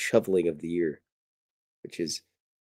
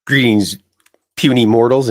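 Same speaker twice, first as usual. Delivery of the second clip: booming alien overlord greeting; 2 semitones higher, 12 dB louder than usual. Acted. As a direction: proclaim it loud like a space ruler. Host greets viewers, grand opener.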